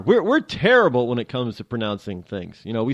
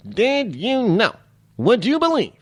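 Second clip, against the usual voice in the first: Get Smart catchphrase; 10 semitones higher than usual. Retold or imitated. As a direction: drag it out like a bumbling TV agent.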